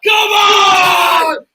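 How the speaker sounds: high, very loud, drawn-out shout; real triumphant bellow; celebratory roar. Real, not acted. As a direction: roar it out after a goal. Roaring after the goal.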